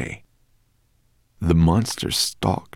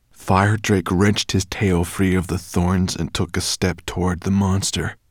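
ordinary delivery

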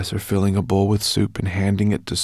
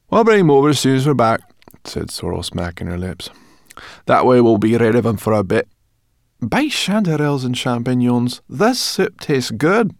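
high, loud, pert brownie voice; saucy little creature smacking her lips; cheeky brownie girl; 5 semitones higher, 8 dB louder than usual. A narrator's reading. Brownie girl Sorrel, cheeky and hungry.

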